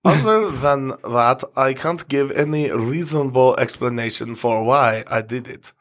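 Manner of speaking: put-on light German accent with a lisp. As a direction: read it in a mock German accent. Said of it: "I just want to do the accent again".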